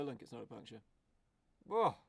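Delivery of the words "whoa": sudden high, loud gasp of a word; startled whoa. Real, not acted. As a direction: caught off guard by the crash. Reacting to crash on screen.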